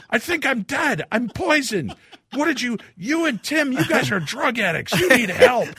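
high, loud mock tirade; hysterical accusing. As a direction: rant at your wife in comic panic. Acted panicked tirade at wife.

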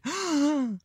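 put-on high excited kid gasp; childlike gasp. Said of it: "he gasped like a kid".

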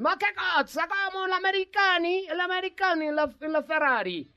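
hammed-up Italian accent, drawing out every question. Host imitating an Italian advising Enzo Ferrari, hammed-up Italian accent. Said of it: "porque así hablan los italianos".

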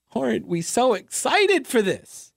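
high-pitched sarcastic enthusiasm; mock excitement. Host mocking the city's art plans, sarcastically excited. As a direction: feign being thrilled.

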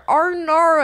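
high, loud, broad Australian "Oh no."; exaggerated Australian "Oh no" as she drops things; broad Aussie oh no. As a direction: squeal "Oh no." in a broad Australian twang. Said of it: "that sounded so that".

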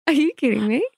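high, incredulous retort; mock disbelief. Mother replaying her reaction to the toddler's trick, incredulous. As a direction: retell your disbelief with rising pitch.

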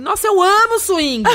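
high, slow, laughing gush; gushing as if swing meant axé dancing; delighted gushing. Retold or imitated. Telling her friend on the phone she loves swing, gushing laughing. Retelling own naive reply to Sato.

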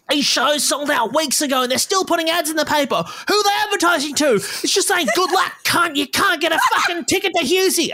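angry comic rant, pitched very high; Dave Hughes. Retold or imitated. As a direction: imitate an outraged comedian in full flow. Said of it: "angry about this wasted money".

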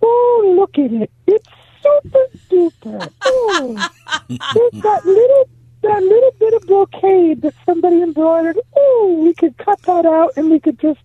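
put-on very high, lilting old lady voice; high sing-song granny gushing over an old sweater; sing-songy grandmother voice. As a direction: coo it like a delighted grandma. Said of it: "in the sing-songy voice".

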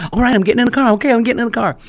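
high, loud hurried surrender; a teenager scrambling to obey; mock teen panicking to get in the car; teen caving in. Teen giving in, voiced.

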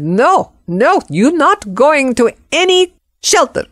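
high, emphatic imitation of an insistent cleaning lady; cleaning lady's protest. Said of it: "my cleaning lady jumped up and said".

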